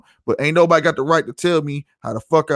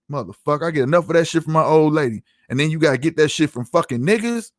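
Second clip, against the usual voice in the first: normal talking voice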